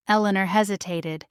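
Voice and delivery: usual speaking voice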